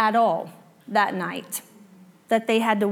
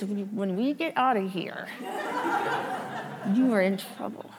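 low, quiet hiss through clenched teeth; through gritted teeth; 3 semitones lower, 8 dB quieter than usual. Retold or imitated. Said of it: "you talk through your teeth like this".

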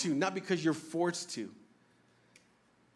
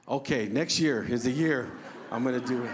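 laughing; 7 semitones lower than usual. Retold or imitated. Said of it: "I said".

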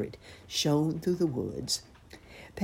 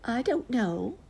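high-pitched drowsy aunt bear; sleepy aunt bear; 9 semitones higher than usual. A narrator's reading. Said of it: "said aunt and tumbled back to sleep".

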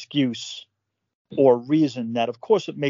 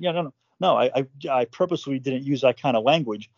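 ordinary talk, nothing marked

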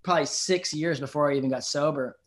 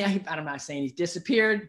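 ordinary talk, nothing marked

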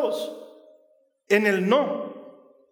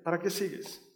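discouraging inner voice; 4 semitones lower, 9 dB quieter than usual. Acted. Voice of discouragement quoted.

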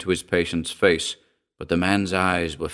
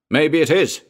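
put-on louder, higher roar of an angry old sailor; roaring old man; 6 semitones higher, 4 dB louder than usual. A narrator's reading. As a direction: bark it back at the doctor. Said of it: "roared the old man".

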